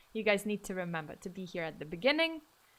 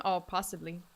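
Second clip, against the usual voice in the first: ordinary delivery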